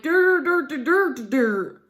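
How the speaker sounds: put-on babbling dur dur like a clueless boyfriend; mocking imitation. Mocking the boyfriend's excuse with a dumb dur dur babble. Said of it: "That's my imitation of him".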